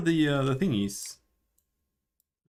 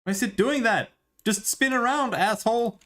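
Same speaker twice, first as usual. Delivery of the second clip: high, loud exasperated yelling; frustrated yelling; 12 semitones higher, 3 dB louder than usual. Real, not acted. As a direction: yell at the screen in frustration. Fighting the camera controls.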